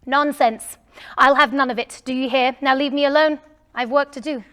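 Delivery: loud, cross dismissal; grumpy Scrooge. Acted. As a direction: send them packing like an old miser.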